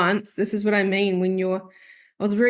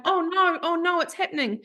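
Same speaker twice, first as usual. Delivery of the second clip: mock panic; 9 semitones higher than usual. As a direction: squeak out a retold dread. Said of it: "I remember going".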